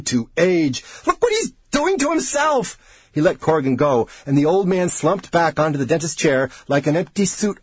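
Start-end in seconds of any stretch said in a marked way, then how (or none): 1.1-2.8 very high, frantic cry; hysterical outburst. A narrator's reading. Researcher defending himself to his colleague, hysterical. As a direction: shriek it out, losing control. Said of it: "let out the hysterical laugh he had been strangling".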